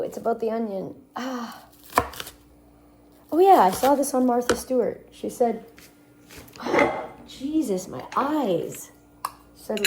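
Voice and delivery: ordinary talk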